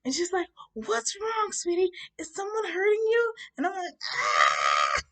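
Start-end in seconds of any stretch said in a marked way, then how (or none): 0.7-3.3 high, loud, alarmed woman; concerned woman. Retold or imitated. 4.1-5.0 loud mock bawling cry; boo-hooing wail. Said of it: "I'm boo-hooing like".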